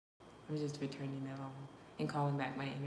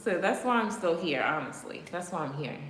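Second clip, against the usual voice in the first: louder defiant statement; 8 dB louder than usual. Real, not acted. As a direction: stand your ground loudly. Defiant declaration.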